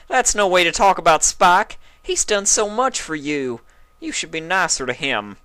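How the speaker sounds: high, loud scolding. A narrator's reading. Applejack defending Spike, loud scolding. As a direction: tell her off firmly. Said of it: "Applejack shouted".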